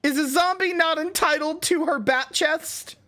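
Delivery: high-pitched defensive whine; squeaky high voice. Real, not acted. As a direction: squeal it out, flustered. Defensive squeaky bat chest rant.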